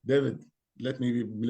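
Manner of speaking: normal talking voice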